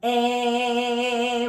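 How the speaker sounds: "Eh" sung bright and open, with a smile; a smiling "Eh" to lift the palate; bright eh vowel. Shown as a demonstration. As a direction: smile wide and sing "Eh". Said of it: "do it with me".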